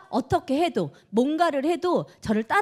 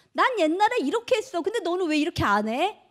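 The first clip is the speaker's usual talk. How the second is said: mock nagging; 6 semitones higher than usual. Imitating nagging.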